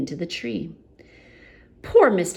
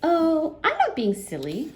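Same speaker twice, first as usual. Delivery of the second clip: goblin voice; 12 semitones higher than usual. Acted. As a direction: point and chirp it. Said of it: "said the goblin".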